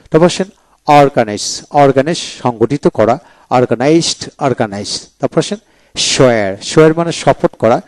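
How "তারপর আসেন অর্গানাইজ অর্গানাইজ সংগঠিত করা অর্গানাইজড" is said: normal talking voice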